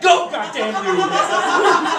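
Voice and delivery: high, loud hammed-up yell; slow, strained action-hero command; heroic scream. Wounded husband orders wife away, screaming heroically. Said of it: "and I scream to her".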